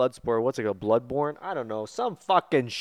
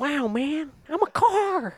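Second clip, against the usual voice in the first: silly cartoon car voice; slower than usual.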